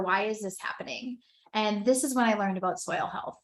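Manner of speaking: ordinary talk, nothing marked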